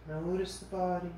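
soft, calm body-scan voice; guided meditation. Acted. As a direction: ease them into rest, unhurried and soft. Leads a relaxation body scan.

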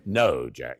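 low, firm correction; patient correction. Retold or imitated. Wife rejects a forehead kiss, firmly. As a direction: correct him in a low, knowing voice.

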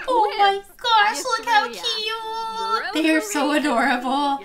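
high, stretched-out squeal of adoration; gasp, then coo high and slow; gushing. Real, not acted. Gushing over cute children.

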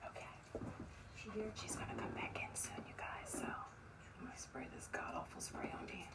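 hushed unvoiced whisper. Real, not acted. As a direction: whisper so she can't hear. Whispering to camera, prank secret.

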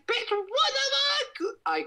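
very high chipmunk-pitched shout; squeaky filter voice. Pretend. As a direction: shriek it in a tiny high cartoon voice. Filter voice reacting to likes.

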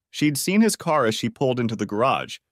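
plain reading voice

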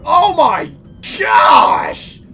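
screaming with delight at the result; high thrilled shouts; amazed shout. Real, not acted. Amazed by game effect.

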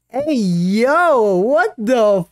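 high, loud, drawn-out outraged cry; shocked yell. Real, not acted. Watching an anime girl, outraged disbelief yell.

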